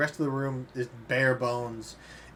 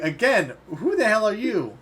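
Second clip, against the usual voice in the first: loud, high-pitched insistence; exasperated demand; 8 semitones higher, 7 dB louder than usual. Acted. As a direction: demand an answer from the intruder, louder and higher. Man repeats demand to intruder.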